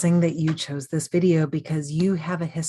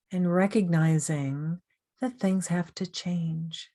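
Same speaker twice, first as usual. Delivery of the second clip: guided meditation. Real, not acted. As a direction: gently guide the listener through breathing and self-thanks, unhurried.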